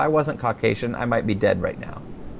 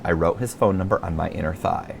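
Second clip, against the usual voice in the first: ordinary delivery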